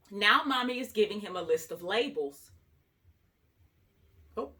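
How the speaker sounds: usual speaking voice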